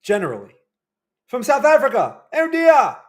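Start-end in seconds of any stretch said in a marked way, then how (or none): 1.3-2.9 very high, loud mock British; hammed-up British exclamation, pitched right up; put-on British accent. Reacting to a South African viewer in a mock British accent. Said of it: "I can't do a South African".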